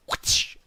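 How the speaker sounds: loud voiceless whoosh; imitated whip whoosh. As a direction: make the sharp rush of a swung cable. Imitates the cable hitting his back.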